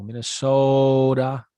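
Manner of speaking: very slow Minnesota, O stretched and stressed; teasing Minnesotan drawl on Minnesota; mock Minnesota accent. Shown as a demonstration. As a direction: drag out Minnesota, hitting the long O. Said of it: "They emphasize the O".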